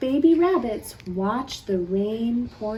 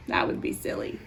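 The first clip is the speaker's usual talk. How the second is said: playful whisper; 7 dB quieter, faster than usual. Acted. Teasing children about the lizard.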